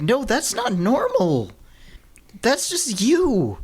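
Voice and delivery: high-pitched, drawn-out objection; incredulous retort. Acted. Podcaster answers the absent author's excuse, incredulous retort. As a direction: push it high in disbelief.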